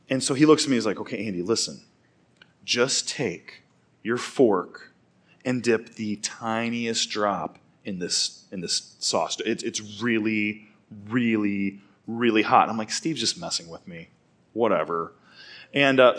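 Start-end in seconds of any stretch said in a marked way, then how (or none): 1.0-12.7 slow, quiet, measured warning; low-key, slow cautioning voice; prankster dad's warning. Retold or imitated. Buddy's dad warns him, retold. 12.9-15.1 dismissive aside. Retold or imitated. Teen brushes off the warning, retold.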